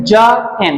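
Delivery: জ, short break, then এন; two separate sounds. Shown as a demonstration. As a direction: split জয়েন into জ and এন. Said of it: "আলাদা আলাদা sound করছে".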